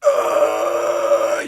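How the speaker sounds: wide scream. Shown as a demonstration. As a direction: open the throat and scream it. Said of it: "Let me try to do this, um, during a scream".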